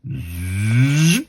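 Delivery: buzzing zzz rising in pitch; drawn-out sizzling buzz; imitated zap. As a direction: make a rising electric zap noise. Imitates comic sound effect.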